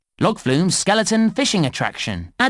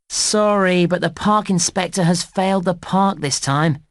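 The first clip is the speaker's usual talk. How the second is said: sustained high, sympathetic apology; apologetic bad news; 4 semitones higher than usual. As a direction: break the bad news gently to a young player. Breaks bad news to player.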